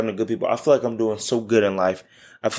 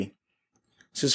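ordinary talk

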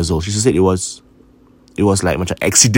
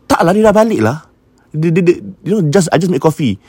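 much higher, brushing it off as nonsense; dismissive disbelief; 9 semitones higher than usual. Retold or imitated. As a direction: wave away the bad news as impossible. Sister dismissing mother's news.

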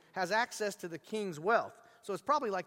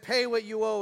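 louder, harsh demand choking a debtor; threatening demand; 3 semitones higher, 6 dB louder than usual. Retold or imitated. Forgiven slave throttling his fellow slave for money. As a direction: grab him and demand the money. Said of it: "Seizing him by the throat".

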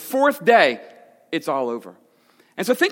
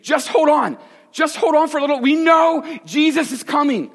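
desperate hope; 7 semitones higher, 3 dB louder than usual. Retold or imitated. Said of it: "they're just thinking".

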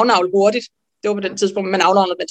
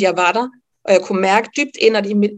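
ordinary talk, nothing marked

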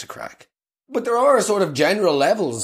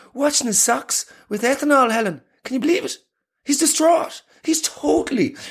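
much higher, scandalised gossip; gossiping wife; 10 semitones higher than usual. Acted. Wife gossiping to Helen about Peter, scandalised and higher. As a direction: dish the dirt like a shocked wife.

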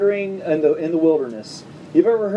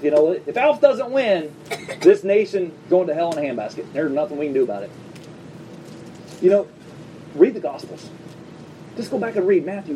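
ordinary delivery

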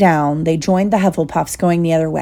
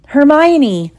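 high, loud cry of a name; alarmed cry; 8 semitones higher, 5 dB louder than usual. Acted. Two friends realise she is trapped with troll, alarmed. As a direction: call out in sudden dread. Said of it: "they said together".

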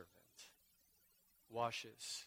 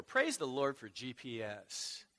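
ordinary delivery

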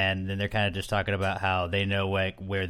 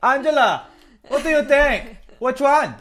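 high, louder mock J C voice; imitating J C; 7 dB louder than usual. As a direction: ask Angela in a high put-on J C voice. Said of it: "trying to imitate his accent".

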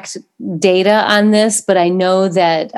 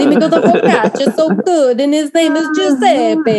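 put-on laughing through an Italian accent; hammed-up Italian accent, cracking up; 5 semitones higher than usual. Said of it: "your great accent?".

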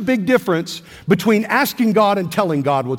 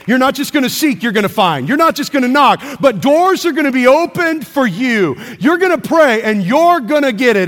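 rising preacher's call, louder; preaching; 4 semitones higher, 3 dB louder than usual. Acted. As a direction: preach it with building fire. Preaching builds to a climax.

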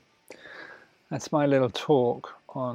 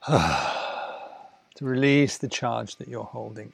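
demo sigh; 3 semitones lower, 3 dB quieter, slower than usual. Shown as a demonstration. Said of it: "I often ask you to sigh".

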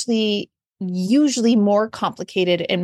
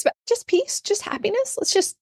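much higher and softer, half-joking plea for peace; airy wishful tone, teasing her own wish list; wistful mock plea; 11 semitones higher, 3 dB quieter than usual. Telling her daughter what gift she wants, a wistful high plea.

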